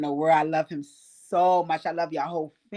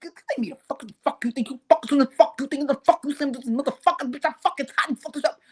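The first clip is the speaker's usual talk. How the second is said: high, fast stammering tirade; imitated high-pitched rage; acted furious rant; 4 semitones higher, faster than usual. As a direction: spit out a frantic run of curses. Acts out furious rant while choking.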